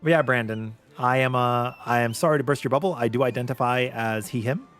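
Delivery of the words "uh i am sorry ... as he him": normal talking voice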